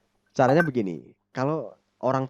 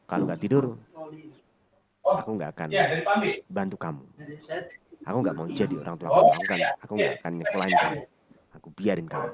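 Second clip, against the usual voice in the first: stern ultimatum; 3 dB louder, faster than usual. Shown as a demonstration. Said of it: "Leading dengan perintah".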